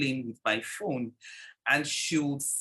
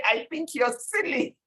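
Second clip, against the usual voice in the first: a higher, lightly voiced little girl teasing; little niece; 9 semitones higher than usual. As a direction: tease Siri like a small niece, only lightly put on. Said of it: "She'd say".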